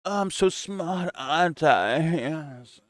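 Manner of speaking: high, slow, self-satisfied pseudo intellectual; smug intellectual impression. Streamer mocks a pundit's pompous way of talking. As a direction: drag it out, high and pleased with yourself. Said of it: "he talks like this".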